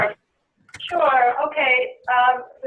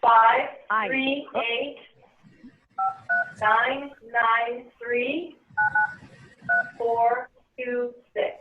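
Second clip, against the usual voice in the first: dictating; slower than usual. Real, not acted. Giving meeting ID digits.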